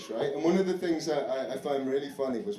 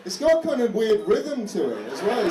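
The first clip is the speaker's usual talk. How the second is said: ordinary talk, nothing marked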